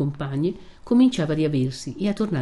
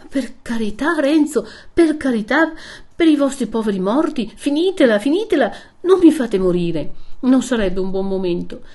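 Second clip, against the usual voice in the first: louder, higher frantic begging; desperate plea; 7 semitones higher, 5 dB louder than usual. Acted. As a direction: beg him to stop, near breaking. Lucia begs him to stop. (supplica disperata, più alta e forte)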